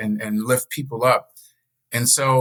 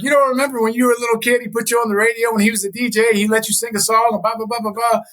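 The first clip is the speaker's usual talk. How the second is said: high, fast mom voice rattling on; 10 semitones higher, faster than usual. Retold or imitated. Mom reminding him of the radio, fast and high. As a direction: rattle it off like his mother. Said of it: "She's like".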